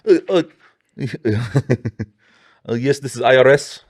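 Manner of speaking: a weak put-on Indian accent; badly faked Indian accent on a scam call. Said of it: "I can't even do a fucking Indian accent".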